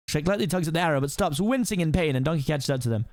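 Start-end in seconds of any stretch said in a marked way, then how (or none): none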